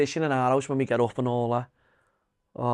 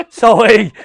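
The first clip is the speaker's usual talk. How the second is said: laughing exclamation; 8 semitones higher, 14 dB louder than usual. Real, not acted. Joking about smoking.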